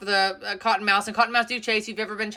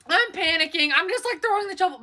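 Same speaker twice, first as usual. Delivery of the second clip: higher, fast panicked telling; panicky; 8 semitones higher than usual. Real, not acted. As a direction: rush it out in panic.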